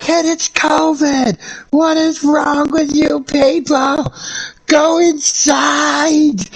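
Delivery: high, slow, shrill-pitched mock scream; imitating a screaming Karen. Retelling the Karen fireworks video, high slow mock scream. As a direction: drag out each word like an outraged neighbour. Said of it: "she came out screaming".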